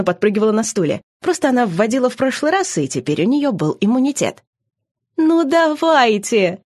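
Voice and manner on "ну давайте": high, slow, drawn-out plea; pleading, drawn out. A narrator's reading. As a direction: beg like a child, stretching the words. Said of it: "умоляюще протянула Мила".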